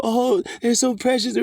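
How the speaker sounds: high, cooing voice of a doting stranger; mock gushing. Preacher mimics strangers praising his child, high mock coo. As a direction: gush over the baby in a sugary mock tone. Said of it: "And everybody's like".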